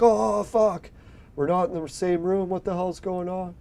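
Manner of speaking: a kid jolting awake in panic, replayed; retold panic. Waking up in a strange room, mildly acted panic.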